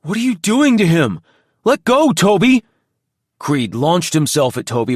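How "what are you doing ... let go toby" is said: alarmed plea. A narrator's reading. Creed pleading during attack.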